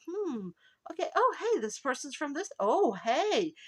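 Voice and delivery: high-pitched, pleasantly surprised musing; intrigued discovery. Retold or imitated. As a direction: act out stumbling on a curious find. Acts out finding something in research.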